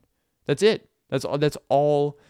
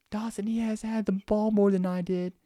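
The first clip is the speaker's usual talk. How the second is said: put-on high, kid voice in protest; complaining little kid; 7 semitones higher than usual. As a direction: imitate a child whining to the coach. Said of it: "oh, they'll let you know".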